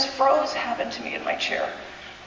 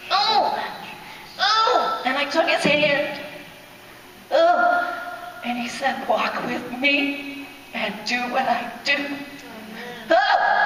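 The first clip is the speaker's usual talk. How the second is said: overwhelmed cries; 4 semitones higher, slower than usual. Real, not acted. Overcome recounting vision.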